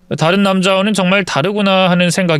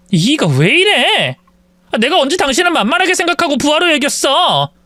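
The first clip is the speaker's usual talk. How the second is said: exasperated wife; 9 semitones higher than usual. Acted. Wife snapping back in quarrel.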